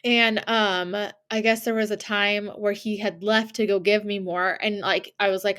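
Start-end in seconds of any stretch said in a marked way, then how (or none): none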